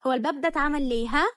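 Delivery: loud angry challenge; snapping at him for barging in. A narrator's reading. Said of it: "بصت له بغضب". (بنت بتزعق لجوزها بغضب على الباب)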